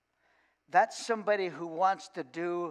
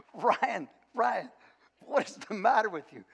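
higher, mock exasperated scold; a milder repeat of the scolding; 4 semitones higher than usual. Coach scolding the player again, exasperated.

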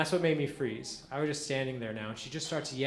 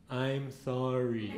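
very slow, dead-serious apology; slow and deliberate; much slower than usual. Acted. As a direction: drag it out, staring her down. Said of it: "I paused, I looked her dead in the eyes, and I went".

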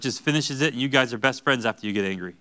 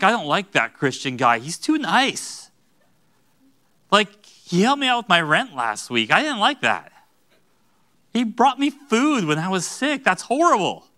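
high, loud, hammed-up grumbling; exaggerated griping with stretched, high words; mock indignation; 7 semitones higher, 4 dB louder than usual. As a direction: complain as if kindness were an outrage.

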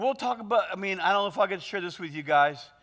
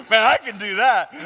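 talked through real laughter, louder; laughing; 7 dB louder than usual. Real, not acted. Preacher still laughing while praising the interpreter. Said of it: "got me laughing".

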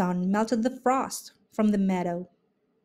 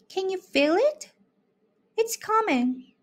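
high, eager anticipation; high excited raccoon voice; 10 semitones higher than usual. A narrator's reading. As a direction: thrill at what's coming. Raccoon excited whisper-song.

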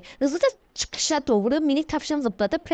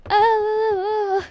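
yüksek, kısa ve sesli şaşkınlık nidası; irkilme nidası; 8 semitones higher, 4 dB louder than usual. Real, not acted. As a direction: aniden irkilip 'aa' de. Ani irkilme. (jump scare bekleyen oyuncunun ani irkilmesi)

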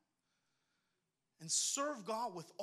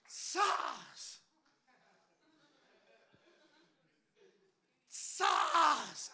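sling whoosh; 5 semitones higher, 3 dB louder than usual. Pretend. Imitating sling whipping.

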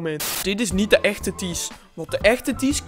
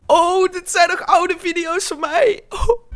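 surprised; 9 semitones higher, slower than usual. Real, not acted. Surprised at old videos.

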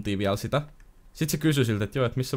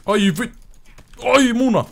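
high, loud ouch yell; pained yelp; 14 semitones higher, 10 dB louder, slower than usual. Real, not acted. Getting hit by the enemy, loud yelp. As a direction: yelp as it hits you.

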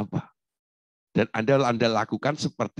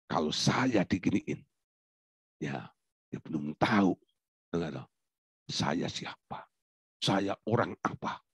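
brooding to oneself; 9 semitones lower, 8 dB quieter than usual. Acted. Wronged person pondering how to react, quiet musing.

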